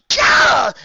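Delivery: a high loud shriek of disgust. Acted. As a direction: explode in revulsion. Trixie disgusted outburst.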